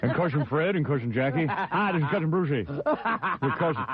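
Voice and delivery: lightly voiced old politician; a hint of a stiff senator drawl; Senator Javits impression. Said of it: "do you like my, uh, Senator Javits impression?".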